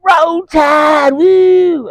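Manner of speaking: very high, shrieking mock college football cheer; drunk fan cheer. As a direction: squeal it like an overexcited fan in the front row. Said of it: "this young lady at one of the front tables is like".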